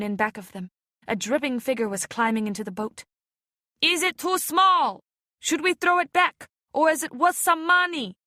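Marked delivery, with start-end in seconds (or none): 3.8-8.2 high, slow mocking fisherman's voice; rough fisherman. A narrator's reading. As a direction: tease the catch like a fisherman weighing a fish. Fisherman hauling a child from the water.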